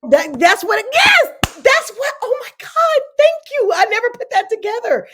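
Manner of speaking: high, loud burst of recognition; light up with sudden realization; excited realization. Real, not acted. Sudden realization, thanking host.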